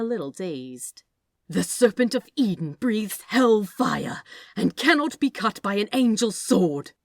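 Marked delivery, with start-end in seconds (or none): 1.5-6.9 loud, higher angry snap; snapped. Acted. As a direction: bite off each word in anger. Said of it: "Aziraphale snapped".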